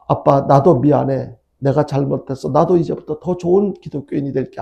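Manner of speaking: usual speaking voice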